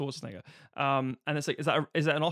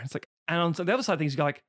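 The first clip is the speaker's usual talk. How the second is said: usual speaking voice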